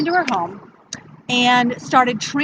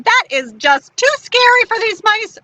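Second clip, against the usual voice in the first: high-pitched, startled objection; alarmed refusal; 11 semitones higher, 4 dB louder than usual. Acted. Woman startled by owl's hoot.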